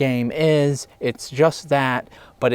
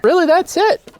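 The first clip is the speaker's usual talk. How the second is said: high squeaky refrain; 13 semitones higher, 3 dB louder than usual. Acted. Catchphrase refrain repeated.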